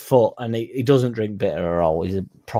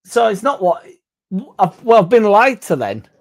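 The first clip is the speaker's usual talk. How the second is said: mock outrage; 11 semitones higher, 8 dB louder than usual. Real, not acted. Caught out by friend's story.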